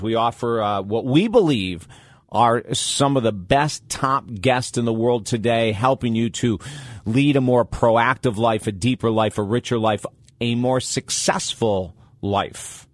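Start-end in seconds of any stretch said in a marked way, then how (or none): none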